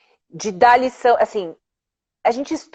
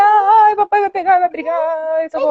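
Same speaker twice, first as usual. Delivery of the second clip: high squeal of pretend panic; playful mock fright; 8 semitones higher than usual. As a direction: squeal as if about to be caught. Mock fright playing with daughter.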